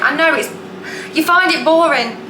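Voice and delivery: ordinary talk, nothing marked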